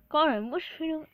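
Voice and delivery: plain reading voice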